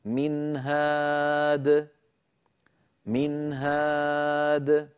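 slow, level chant of "من هاد", long aa, short noon; clear izhar. Shown as a demonstration. Teacher demonstrating the haa example "من هاد", slow recitation with clear noon. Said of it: "لم أزد في زمن التنوين".

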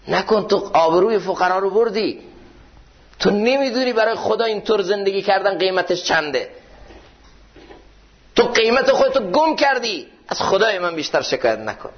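preacher voicing a saint's rebuke; rising preaching rebuke. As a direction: scold him gently but fervently, climbing in pitch. Ibrahim rebukes the complaining man.

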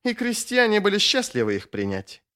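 high-pitched, curt insistence; sharp retort. A narrator's reading. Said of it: "резко высказался Сульт".

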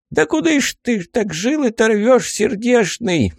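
put-on high cranky old man; grumbling old geezer. Old man scolds the dying.

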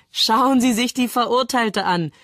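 high-pitched amused outburst; blurted out. A narrator's reading. Kramer points at the condemned woman, blurting it out. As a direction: force it out, barely recovered from laughing. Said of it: "stößt er hervor".

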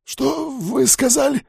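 high, shocked question; stunned. A narrator's reading. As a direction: gasp it out in disbelief. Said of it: "опешил начальник стражи".

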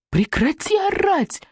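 high, slow shout; shouting mother. A narrator's reading. Exasperated mother shouts at her screaming son, high and slow. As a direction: yell it at the screaming child. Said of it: "прокричала она".

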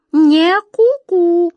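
high, loud, slow cuckoo call; sly cuckoo. Acted. Cuckoo contradicting the wizard's guess, slyly. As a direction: tease slowly like a sly bird. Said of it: "лукаво посмотрела на него серая птичка".

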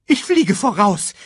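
high, loud urgent cry; bird's shout. A narrator's reading. Sasu sets off to find Simba, shouting. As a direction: shout it out high as you take off. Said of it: "schrie Sasu".

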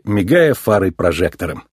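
plain reading voice

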